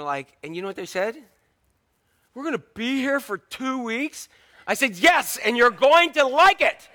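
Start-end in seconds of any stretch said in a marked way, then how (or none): 2.3-4.3 higher, slow dismayed whine; mock the kids' horror at the trip; whiny kid. Kids dismayed at long trip. 5.0-6.9 slow, louder, higher with a laugh; mock stern dad. Father answers the kids.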